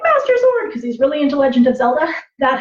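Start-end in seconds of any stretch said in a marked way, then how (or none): 0.0-0.7 put-on high, excited little boy shout; excited kid. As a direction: call it out like a thrilled kid. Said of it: "and my son's like".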